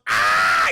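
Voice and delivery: sudden loud mock scream; imitated scream. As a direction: let out a creature's scream of pain. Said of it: "He just starts screaming".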